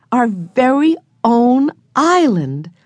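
put-on a boy spotting his island; excited cry. A narrator's reading. Henry to family in the boat, a slow, high excited cry. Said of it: "cried Henry".

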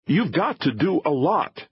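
high-pitched, urgent demand; forceful, urgent command; insistent. A narrator's reading. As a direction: press hard, pitched well up. Filmmaker urgently stops the agent.

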